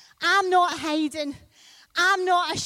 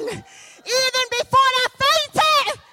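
triumphant shout; 12 semitones higher, 10 dB louder, slower than usual. Acted. Preacher builds to a climax.

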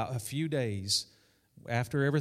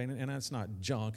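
ordinary talk, nothing marked

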